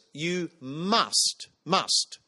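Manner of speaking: MUST repeated and pressed hard; emphatic must. Shown as a demonstration. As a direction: lean on "must" each time, insistent. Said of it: "It is emphatic".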